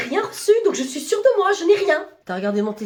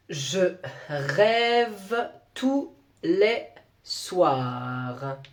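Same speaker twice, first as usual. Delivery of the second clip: very slow, drawn-out dictation read; 6 semitones lower, 4 dB quieter, much slower than usual. Shown as a demonstration. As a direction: spell it out word by word like a teacher. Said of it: "Attention, sur rêve, il y a un accent".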